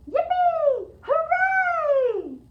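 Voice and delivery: put-on very high, loud kids' cheer; excited cheer. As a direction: shout it like thrilled children. Kids cheering about parade.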